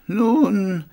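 high, hesitant opener; a touch of sheepishness; embarrassed. A narrator's reading. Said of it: "begann Kehrer verlegen".

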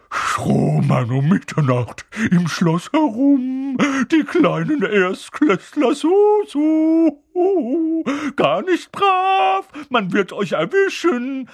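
very high, loud, drawn-out singsong teasing; mock them like a gleeful poltergeist; cackling taunt. A narrator's reading. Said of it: "Peeves lachte gackernd".

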